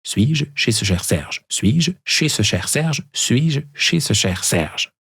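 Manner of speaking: slow, even repetitions of «Suis-je chez ce cher Serge?»; repeated tongue twister. Shown as a demonstration. Host repeats the twister for listeners to copy, measured. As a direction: drill it over and over, steady. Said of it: "essayez de répéter trois fois".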